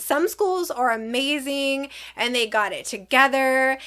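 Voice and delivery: normal talking voice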